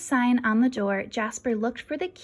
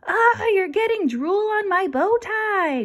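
higher, louder groaning complaint; 11 semitones higher, 8 dB louder than usual. Acted. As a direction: groan it out in mock annoyance. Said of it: "groaned Jasper".

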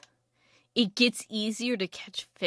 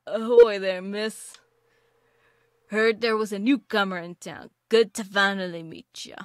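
a hammed-up pirate greeting, louder; lightly voiced pirate, louder at the start; terrible pirate voice; 7 dB louder than usual. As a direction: play a badly done old sea dog hailing a newcomer. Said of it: "That is a terrible pirate impression".

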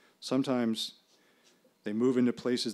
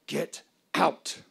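higher, louder angry hiss through teeth; 6 semitones higher, 5 dB louder than usual. Retold or imitated. Narrator ordering the entity away, angry hiss through teeth. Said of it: "I hiss between my teeth".

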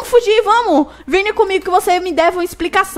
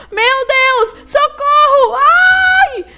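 being chased; 9 semitones higher, much slower than usual. Real, not acted. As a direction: yell for help while fleeing. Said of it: "ele tá vindo atrás de mim".

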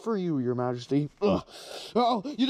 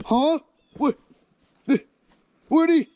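startled cry; 5 semitones higher, 5 dB louder than usual. Acted. Villain loses his foe.

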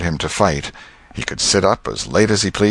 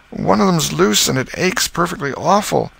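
put-on whiny boy; 11 semitones higher than usual. Tom complains to aunt.

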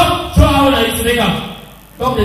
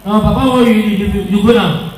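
normal talking voice

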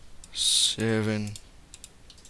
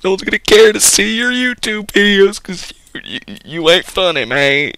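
much higher, loud mocking mother; mom voice; 8 semitones higher, 9 dB louder than usual. Mother telling her son he isn't funny, high and loud. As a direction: nag like a dismissive mom. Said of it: "Mom was right".

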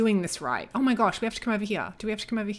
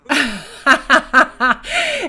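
big, high burst of laughter; loud laughing fit; 4 semitones higher, 8 dB louder than usual. Real, not acted. Gamer laughing at the failed bribe, highly amused.

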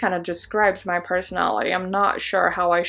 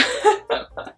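breathy little laugh; 13 semitones higher than usual. Real, not acted. Amused at own verdict.

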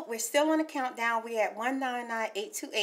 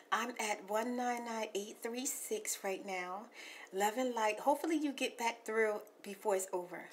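softer, hushed caller messages; soft hushed ASMR messages; 5 semitones lower, 8 dB quieter than usual. Acted. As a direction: talk gently like ASMR to a caller. Soft ASMR voicemail talk.

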